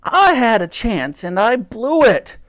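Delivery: high, loud, drawn-out wail; wailing in despair. A narrator's reading. Titch laments his failed shot, wailing in despair. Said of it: "Titch wailed in despair".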